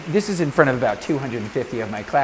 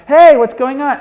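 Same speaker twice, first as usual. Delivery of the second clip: high, loud delighted hello; loud, high greeting to the stars; excited greeting; 10 semitones higher, 12 dB louder than usual. Retold or imitated. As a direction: call out like you belong there. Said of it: "And I'm like".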